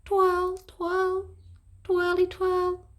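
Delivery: high, lilting twirl, twirl; sing-song twirls. A narrator's reading. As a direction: sing it like a dainty dance. The baddies forced to twirl.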